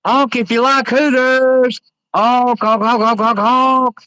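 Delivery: high, loud silly clown chant. Acted. Silly honking chant at passing car.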